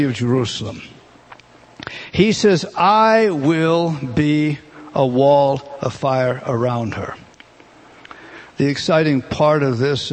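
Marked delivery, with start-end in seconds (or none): 2.8-7.1 slow, weighty, word by word; solemn scripture quote. Acted. Preacher quoting the Lord's promise about Jerusalem, slow and weighty.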